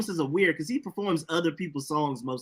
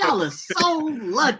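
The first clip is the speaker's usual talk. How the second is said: high, slow, laughing; 10 semitones higher than usual. Acted. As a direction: draw it out with a laugh. Mock envy.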